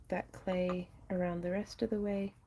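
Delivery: ordinary delivery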